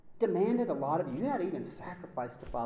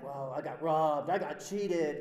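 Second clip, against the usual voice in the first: petty whining grievance; 3 semitones lower, 3 dB louder, slower than usual. Retold or imitated. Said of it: "we say things like".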